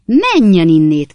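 loud, slow outraged shout; indignant yell; angry shout. A narrator's reading. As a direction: shove him off with your voice. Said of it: "kiáltotta Sam".

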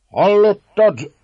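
loud, high, slow call; Márton urging György on. A narrator's reading. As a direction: cry out in triumph. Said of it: "kiálta Márton".

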